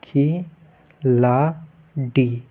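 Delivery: खिलाड़ी stretched very slowly, each sound held; teacher slowly sounding out खिलाड़ी for students; drawn-out demo word. Shown as a demonstration. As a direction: spell out खिलाड़ी syllable by syllable, very slow. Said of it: "ये हो गया आपका खिलाड़ी".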